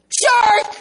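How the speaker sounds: sudden high-pitched yell imitating the wife; retold yell. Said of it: "and she yelled".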